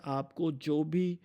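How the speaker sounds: normal talking voice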